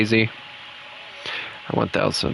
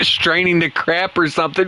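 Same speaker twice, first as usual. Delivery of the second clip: usual speaking voice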